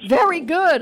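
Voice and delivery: high, loud quiz-host congratulation; delighted approval. Real, not acted. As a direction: cheer the right answer brightly and loudly. Cheering a correct quiz answer.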